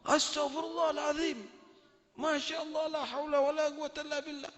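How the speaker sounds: she screams in horror; shocked scream. Acted. Wife shrieks on seeing the beggar's face, high and shocked. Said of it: "menjerit dia".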